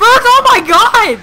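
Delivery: high, loud, slow terrified cry; frightened scream. Real, not acted. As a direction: shriek as the birds swarm. Startled by birds attacking.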